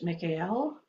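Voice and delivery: Michael said as mee-kah-EL; Hebrew pronunciation. Shown as a demonstration. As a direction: say Michael the Hebrew way, three syllables, stressed EL. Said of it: "is a pronunciation of Michael's name".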